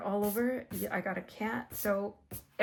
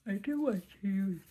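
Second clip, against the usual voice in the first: yawning. Real, not acted. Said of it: "oh, I'm so sorry, guys".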